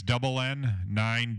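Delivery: ordinary delivery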